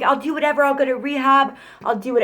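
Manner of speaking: high, pleading mock voice; desperate promises. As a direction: promise anything in a whiny plea. Imitating boyfriend's desperate promises.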